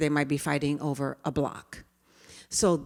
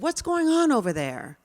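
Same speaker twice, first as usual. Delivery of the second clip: calling out; 8 semitones higher, 4 dB louder than usual. Retold or imitated. Teacher calls across at ruckus.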